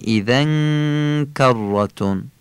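ایذن کرتون slow, nasal ng held into the k; stretched tanwin. Shown as a demonstration. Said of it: "تنوین اذن کشیده در کاف". (ایذن کرتون با تنوینِ کشیده در کاف)

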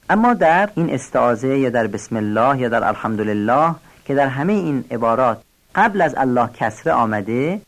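ordinary delivery

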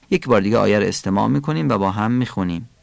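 normal talking voice

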